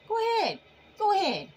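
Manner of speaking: high, loud go ahead, daring them; taunting dare. Acted. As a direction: goad them to try it. Taunting the enemy to try.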